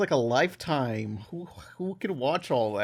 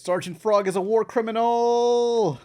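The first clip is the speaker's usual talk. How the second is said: loud, high outburst; excited shout; 10 semitones higher, 6 dB louder than usual. Real, not acted. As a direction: blurt it out with glee. Outburst about cartoon frog.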